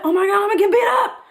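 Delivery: high, fast panicked shout; acted frantic freak-out. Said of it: "I'm freaking out".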